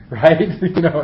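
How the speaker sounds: real laugh on the words. Real, not acted. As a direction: laugh it off lightly. Laughs at own remark.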